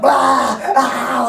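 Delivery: loud, high yelp of alarm; hammed-up screams at a nosebleed; mock panicked scream.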